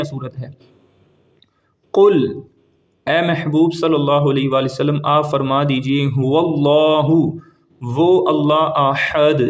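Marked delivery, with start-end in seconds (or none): 6.1-9.4 slow chanted verse; slow tajweed recitation; Quranic recitation. Shown as a demonstration. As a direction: draw the words out melodically. Recites verse between translations.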